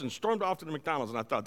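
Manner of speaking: ordinary talk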